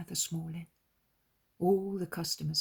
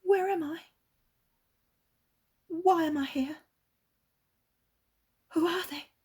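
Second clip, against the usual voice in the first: higher, lost and amazed; bewildered girl; 13 semitones higher, 6 dB louder than usual. Acted. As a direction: wake up confused in a stranger's stable. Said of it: "calmly, sadly, asks questions, expressing her amazement".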